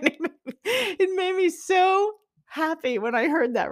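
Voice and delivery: high, loud, laughing and near tears; laughing through tears. Real, not acted. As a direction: overcome with happy emotion. Moved remembering her father's voice.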